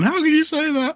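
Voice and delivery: very high, whiny mock sobbing voice; mock crying. Teasing friend mimics Laura on the phone, mock crying. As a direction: imitate someone wailing down the phone. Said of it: "Crying down the phone".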